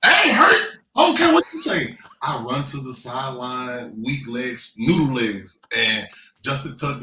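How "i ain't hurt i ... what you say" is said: high, loud strained denial; defiant retort. Retold or imitated. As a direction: insist you're fine, voice cracking high. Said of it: "I said".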